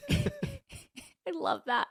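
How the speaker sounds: high, slow swooning coo; gushing. Real, not acted. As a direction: melt over it in a high, drawn-out voice. Gushing over grandma's bop walk.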